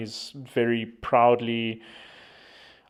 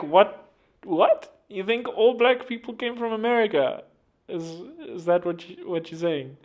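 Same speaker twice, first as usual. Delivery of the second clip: mock disbelief, voice jumping higher; incredulous; 8 semitones higher than usual. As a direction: act baffled, pitch up on each what. Mocking Americans abroad, incredulous.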